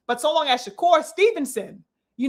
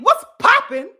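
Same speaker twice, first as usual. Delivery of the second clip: taunting quote; 8 semitones higher, 7 dB louder than usual. Retold or imitated. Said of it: "and saying".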